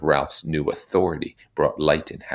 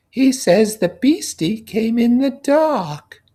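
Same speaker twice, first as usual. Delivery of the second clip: put-on high, childlike voice, much higher and louder; piping kid relaying a small boy's fear; little boy's go-between; 8 dB louder, slower than usual. Piggy passing on the little boy's question in a high childlike voice.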